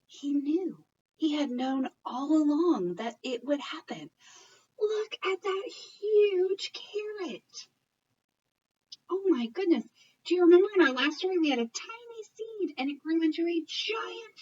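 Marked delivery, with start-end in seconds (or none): 4.8-9.8 amazed. Acted. Amazed at the giant carrot.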